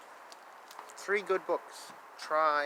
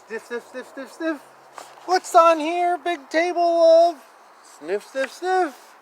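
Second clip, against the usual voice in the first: sniffing dog; 11 semitones higher, 3 dB louder than usual. Pretend. Voicing the dog for the child, high sniffing. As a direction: sniff around like a curious pup.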